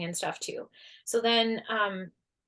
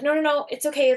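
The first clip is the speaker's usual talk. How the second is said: higher, louder flustered no, no, no; flustered refusal; 6 semitones higher, 7 dB louder than usual. Retold or imitated. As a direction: hurriedly wave off her offer. Refusing stranger's offer to come.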